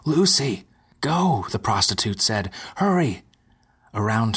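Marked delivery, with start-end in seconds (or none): 0.1-1.5 high, urgent plea; urgent warning. Acted. Prostitute urges Lucy to flee. 2.8-3.2 high urgent cry; urgent plea. Acted. Prostitute urges Lucy.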